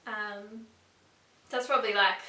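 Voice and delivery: usual speaking voice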